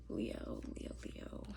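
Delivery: very soft, voiceless whisper; whispering to oneself. Real, not acted. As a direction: whisper it absently under your breath. Murmuring the sign to herself.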